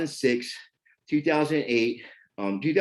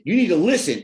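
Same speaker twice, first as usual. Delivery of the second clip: loud, higher furious order; aggressive; 7 semitones higher, 7 dB louder than usual. Retold or imitated. Father threatening his stepson, aggressive. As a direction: drive the words at him, threatening. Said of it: "I was very, like, aggressive".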